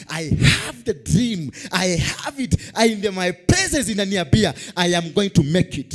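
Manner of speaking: loud shouted preaching; tv preacher. Acted. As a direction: shout it to the crowd, slowly. Preaching climax.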